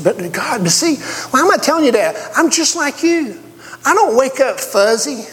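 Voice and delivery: high, ringing preacher voice; impassioned preaching. Acted. Preacher admitting his faults to the church with fervor. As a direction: lift the church with rising fervor.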